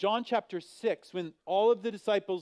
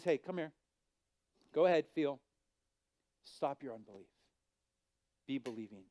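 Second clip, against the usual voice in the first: gentle; 2 semitones lower, 4 dB quieter than usual. Acted. Jesus inviting doubting Thomas to touch, soft and gentle. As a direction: coax him kindly, almost hushed.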